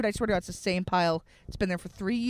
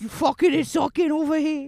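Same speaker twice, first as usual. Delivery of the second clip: street tough voice; 5 semitones higher, 5 dB louder than usual. Pretend. Mock street voice.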